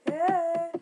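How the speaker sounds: loud, high yell of frustration; sudden high outburst; annoyed shout. Real, not acted. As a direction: shout at a computer that won't obey. Snaps at his stuck computer.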